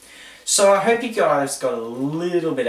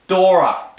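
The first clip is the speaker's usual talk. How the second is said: loud, high shout of the name; excited call; 7 semitones higher, 11 dB louder than usual. Acted. Host calling out Dora to start the example.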